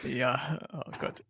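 gasping after a strong sip; while sipping a drink. Real, not acted. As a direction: breathe it out after the schnapps burns. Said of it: "seinen Schnaps".

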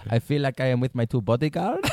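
put-on French accent, laughing; hammed-up French accent; mock French accent. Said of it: "Nikki's like".

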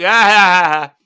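loud braying ha-ha; repeated loud guffaw; mocking laugh. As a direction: keep braying at him. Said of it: "But he keeps".

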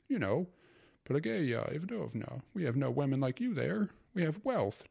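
put-on a sentimental uncle confiding in a young wife; wistful uncle. A narrator's reading.

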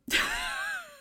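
high shriek of laughter at own joke. Real, not acted. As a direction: burst into a squealing laugh.

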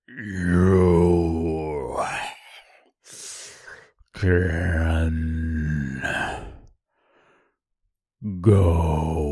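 low, slow gurgling monster voice; deep gurgling brute. Acted. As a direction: growl it out like a brute. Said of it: "in a deep, gurgling voice".

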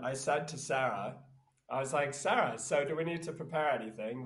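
ordinary delivery

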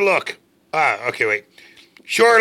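usual speaking voice

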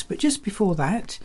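ordinary talk, nothing marked

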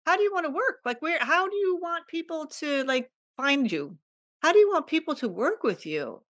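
high, quick, eager voice of the universe; the universe talking. Retold or imitated. The universe offering her a deposit, in a high, quick, eager voice. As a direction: play the universe as a bubbly helper offering money. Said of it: "for the universe to say".

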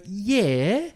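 overconfident, bright yeah sung upward; cocky yeah. Retold or imitated. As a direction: brush it off like an old pro. Over-confident answer to staff.

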